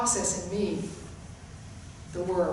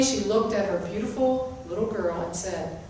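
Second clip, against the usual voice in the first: ordinary talk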